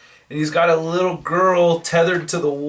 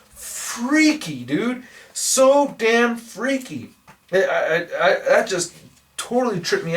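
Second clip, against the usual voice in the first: high, drawn-out "freaky" in real disgust; freaked out; 7 semitones higher, slower than usual. Real, not acted. Vlogger reacting to the governor's zombie heads, high and slow. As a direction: let it creep you out, higher and slower.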